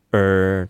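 sustained muffled "うー"; muffled vowel. Shown as a demonstration. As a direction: keep the mouth nearly shut on "うー". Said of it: "音をこもるような形で".

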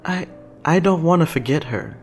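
put-on tender boyish hesitation; holding back tears. A narrator's reading. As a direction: stumble in as if trying not to cry. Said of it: "he's trying not to cry".

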